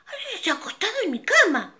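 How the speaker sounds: mama bear. Acted. Mama bear finds her bed used, high and fast. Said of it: "El oso mediano dijo".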